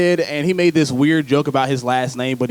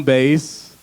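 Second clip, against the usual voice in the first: ordinary delivery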